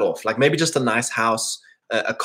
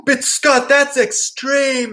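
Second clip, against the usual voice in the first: much higher, slow protesting voice; shocked objector; 12 semitones higher, 3 dB louder, much slower than usual. Retold or imitated. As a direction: play the appalled critic. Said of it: "And it's like".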